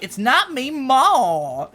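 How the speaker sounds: slow, high Southern grandma voice; Southern accent. Retold or imitated. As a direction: imitate a stern Southern grandma. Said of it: "She has, like, a really thick Southern accent".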